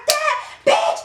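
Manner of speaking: very high re-enacted cry of rage; outraged outburst. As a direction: act out fury at the replay. Acting out rage at seeing footage.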